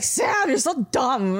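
drawn-out, groggy mock annoyance; annoyed. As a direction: slowly grumble at your sister, half out of it. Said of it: "like, annoyed at her".

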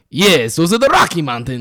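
light imitated Polish accent. Coworker confidently naming the mountains, mild Polish accent. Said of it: "one of the other Polish guys".

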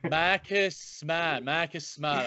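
slow Boston accent, r's dropped. Shown as a demonstration. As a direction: drawl it like a Boston local. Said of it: "my Boston accent is, is seriously unfair".

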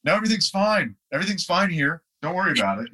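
high-pitched, falsely breezy reassurance; mock reassurance. Musician on phone to girlfriend, mock reassuring. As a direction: insist all is well, unconvincingly, in a high voice.

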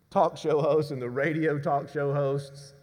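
usual speaking voice

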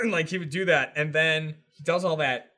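ordinary delivery

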